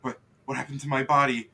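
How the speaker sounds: loud panicked shriek; horrified. Acted. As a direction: scream in horror at your own body. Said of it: "he goes".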